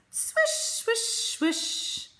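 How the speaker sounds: high, fading whispered swishes. Acted. Mole tickling the sleeping bear's nose, whispered. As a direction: breathe each swish out with no voice.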